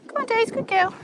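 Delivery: high sing-song praise for a good dog. Real, not acted. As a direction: coax the dog along, high and bright. Calling encouragement to her dog.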